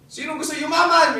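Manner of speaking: loud, high seminar shout to the crowd; shouting speaker. Retold or imitated. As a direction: shout it out like a hyped-up seminar host. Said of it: "Sumisigaw yung speaker sa harapan". (sumisigaw na parang speaker sa seminar)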